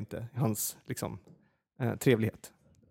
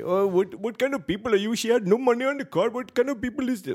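high-pitched imitation of an outraged man; mock indignant ranting; 10 semitones higher, 3 dB louder than usual. Man at the counter griping about a customer, mock high-pitched indignation. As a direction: complain in a put-on, much higher voice.

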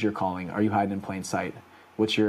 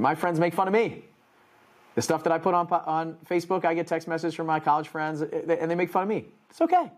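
imitating her; 8 semitones higher than usual. Speaker quoting her mocked struggle.

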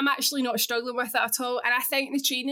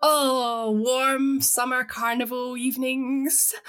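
dreamy longing; slower than usual. Retold or imitated. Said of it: "I'm like".

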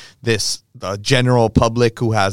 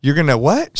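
taken-aback pushback; 6 semitones higher, 4 dB louder than usual. Retold or imitated. Reacting to her demand.

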